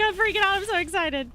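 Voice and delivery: high, loud excitement; excited quote. Retold or imitated. Streamer telling the creator she's thrilled, high and loud. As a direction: squeal it out, thrilled. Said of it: "I was like".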